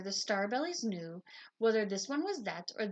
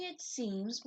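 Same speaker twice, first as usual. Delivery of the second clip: ordinary delivery, nothing marked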